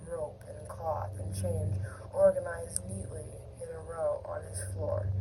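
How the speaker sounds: soft, low story reading; hushed story narration. A narrator's reading. Reader narrating a tense captive scene, hushed narration. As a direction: read it quietly and intimately. Reads fiction aloud.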